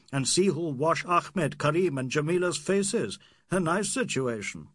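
lightly voiced scornful young Indian doctor; a touch of teasing scorn; Aziz, mocking. Aziz mocking Fielding's question, lightly scornful.

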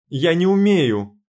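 a slow, loud high cry; outcry. A narrator's reading. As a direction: cry it out in despair. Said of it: "выкрикнула я".

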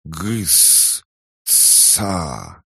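hissy, garbled creature syllables; indignant creature. A narrator's reading. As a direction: spit out half-formed words indignantly. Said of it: "негодующе застрекотала лапами". (негодующий лепет зверька)

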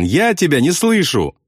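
high-pitched shout at contained volume. A narrator's reading. Pilot shouts back to bombardier, high shout. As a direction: call out high over a din. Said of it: "крикнул Кроха Сэмпсон".

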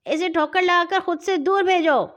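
high shout across the pitch; called out loud. A narrator's reading. Captain shouts to Pippa on the pitch, high and loud. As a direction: yell the instruction to her. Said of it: "زور سے بولا".

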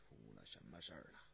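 very low, soft questioning; deep, hushed imperial displeasure; frowning emperor. Acted. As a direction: ask quietly with a frown. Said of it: "皱着眉问道".

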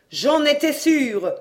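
triumphant I-knew-it outburst; exclaiming. A narrator's reading. Mother crying out at the murder news, vindicated. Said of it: "s'écria-t-elle".

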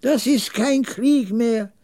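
high, slow, drawn-out resignation; resigned old man. A narrator's reading. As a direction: lament it slowly in a high old voice. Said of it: "das sehr resigniert klang".

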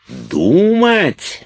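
extremely high, loud shriek of disbelief; outraged scream. A narrator's reading. Уилт insulted as not a man, outraged scream. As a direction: scream the word back in offence. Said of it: "завопил Уилт".

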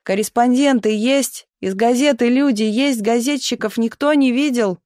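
mild shout for someone unseen; calling out. A narrator's reading. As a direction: call out searching along the line. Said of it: "на разные голоса кричал".

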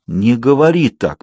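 ordinary delivery

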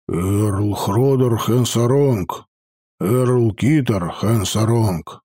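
медленно, с почтением, ниже; гном торжественно называет эрлов; торжественное приветствие. Acted. (торжественно, медленно)

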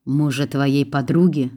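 ordinary delivery, nothing marked

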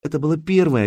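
plain reading voice

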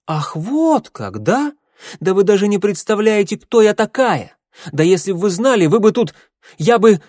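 high, loud, sputtering fury; outraged rant breaking off mid-threat; enraged outburst. A narrator's reading.

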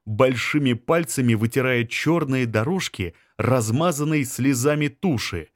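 plain reading voice

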